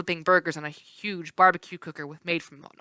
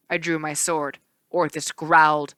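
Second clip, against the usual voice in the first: ordinary delivery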